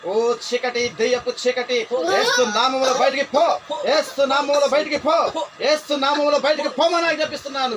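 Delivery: thundering deliverance preacher; exorcism command. Real, not acted. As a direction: drive the demon out with full force. Commands the spirit to leave.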